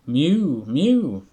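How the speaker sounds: loud high insistent mew; a hungry cat cutting in; plaintive cat cry, loud. Acted. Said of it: "interrupted Simpkin".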